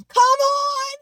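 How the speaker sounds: shrieking high praise shout; high excited cry. Real, not acted. Recalling church, she cries out in a high loud shout.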